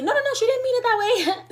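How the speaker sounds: very high-pitched, hurried smoothing-over; squeaky imitated peacemaker interjection; hasty placating. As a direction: jump in high to defuse an argument. Cutting in to smooth things over.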